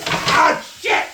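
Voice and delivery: high fake cry of pain; mock pain yelp. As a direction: yelp as if cut. No, I'm just kidding.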